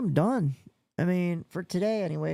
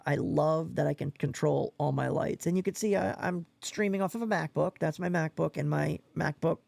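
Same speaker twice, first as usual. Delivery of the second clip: ordinary talk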